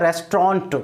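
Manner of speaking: रेस्टोरेंट as RES-tront; रेस्टोरेंट with "tr" run together; correct pronunciation. Shown as a demonstration. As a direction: squeeze रेस्टोरेंट into two syllables, "res-tront". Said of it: "इसका pronunciation होता है".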